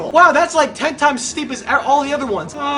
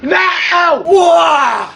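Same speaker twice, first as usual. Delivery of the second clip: loud, high cry of dismay; frustrated shout; 5 semitones higher, 5 dB louder than usual. Real, not acted. Player failing a level, shouting in dismay. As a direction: shout it as it all goes wrong.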